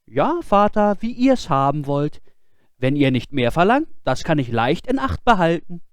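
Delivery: put-on high, earnest boyish voice; boy's voice. Boy explaining himself to his scolding father, high and earnest. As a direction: plead innocence.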